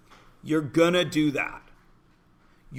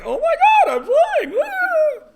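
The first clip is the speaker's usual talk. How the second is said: high, loud mock thrill; thrilled brain; 6 dB louder than usual. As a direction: squeal like you're soaring on a ride. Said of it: "your brain is like".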